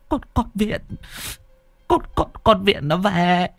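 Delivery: high, stuttering voice of shock; stammering terror. Acted. Aunt stammers to the neighbours about the ghost, high terrified stutter. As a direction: stammer it out, still shaking with fright.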